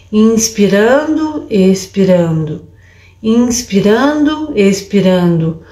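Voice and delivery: drawn-out inspirando, expirando, falling on expirando; slowly label each breath aloud. Shown as a demonstration. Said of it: "você pode falar inspirando".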